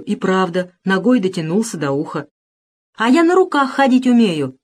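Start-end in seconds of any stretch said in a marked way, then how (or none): 2.9-4.6 громкий хвастливый крик; крикнуть, чтобы заметили; выкрикивая. A narrator's reading. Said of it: "кричит второй". (второй мальчишка кричит атаману, хвастаясь)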